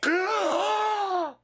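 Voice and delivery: high, loud gasping cry; loud gasp back to life. Acted. Revived player sucks in first breath, loud high gasp. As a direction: gasp as if yanked back from death.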